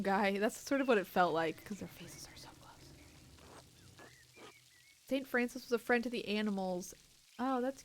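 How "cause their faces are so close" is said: breathy, unvoiced whisper, much softer. Player mimicking the close talk, whispered. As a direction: hush it as if faces almost touch. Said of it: "whisper it to him".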